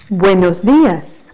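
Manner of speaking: normal talking voice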